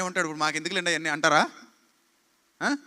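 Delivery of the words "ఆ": ordinary delivery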